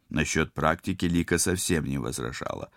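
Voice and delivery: plain reading voice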